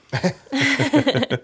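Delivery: high burst of laughter; laughing. Real, not acted. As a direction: laugh at the tough question. Laughs at a hard question.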